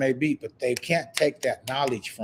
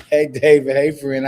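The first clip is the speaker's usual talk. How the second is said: loud greeting; 2 semitones higher, 7 dB louder than usual. Real, not acted. Greeting a viewer by name.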